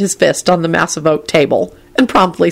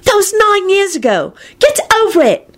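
disgusted yell. A narrator's reading. Ginny scolding over stolen silver, disgusted yell. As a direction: shriek it in high-pitched disgust. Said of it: "yelled out in a disgusted voice".

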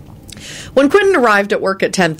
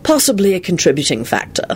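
dry. A narrator's reading. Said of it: "Lupin replied dryly".

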